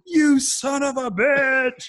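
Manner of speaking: over-the-top mock outrage; mock furious yell. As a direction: drag out a hammed-up curse. Mock rage at IKEA task.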